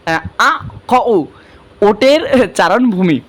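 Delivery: teacher shows students how hard the word is to pronounce, slow and halting; struggling pronunciation. Shown as a demonstration. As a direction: stretch out the sounds as if they're hard to say. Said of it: "দেখো তো ভাই উচ্চারণ করতে কতটা কষ্ট হইতেছে।".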